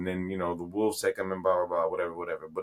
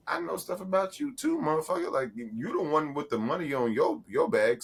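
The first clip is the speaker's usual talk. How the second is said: higher-pitched mock counter-threat; 8 semitones higher than usual. Blackmailer to an accomplice who threatens to tell, mock defiant. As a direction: throw the accusation back, voice pitched up.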